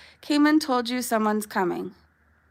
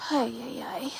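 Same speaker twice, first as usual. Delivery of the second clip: whispered ay; 10 dB quieter than usual. Real, not acted. Dog chewing her.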